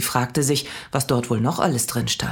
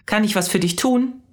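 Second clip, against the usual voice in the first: touch of irritation, higher; irritated; 8 semitones higher than usual. A narrator's reading. Saleswoman asking Mia, mildly irritated. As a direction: ask it impatiently. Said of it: "fragte sie dann gereizt".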